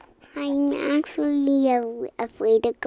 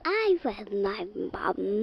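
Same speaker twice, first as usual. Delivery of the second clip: ordinary delivery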